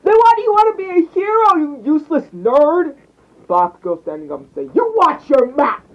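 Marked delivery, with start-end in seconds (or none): 0.0-3.0 high, loud jeering shout; shrieked schoolyard jeer; mocking classmates. A narrator's reading. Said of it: "the class would burst out laughing". 4.8-5.9 loud, slow, raised-pitch threat; forceful higher-pitched scolding; angry warning. A narrator's reading. Boy defends friend against class.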